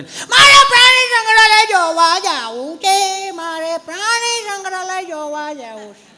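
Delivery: put-on high, slow, sing-song kid's wail; whining child. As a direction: whine it like a stubborn boy. (ઊંચા સાદે રડમસ બાળકનો કકળાટ)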